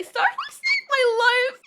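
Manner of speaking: high, slow whimpering fake sob; mock fake crying. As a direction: ham up a phony teary confession. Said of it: "Am I selling it?".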